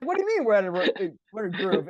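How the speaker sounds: high-pitched mock indignation; incredulous. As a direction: throw the praise back in comic disbelief. Elephant challenges the rider's praise.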